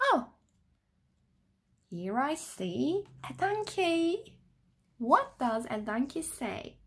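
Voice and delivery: plain reading voice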